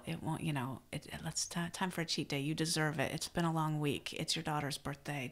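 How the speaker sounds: soft, low coaxing voice; whispering saboteur. Acted. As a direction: tempt them quietly, like a little inner saboteur. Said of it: "the saboteur who whispers".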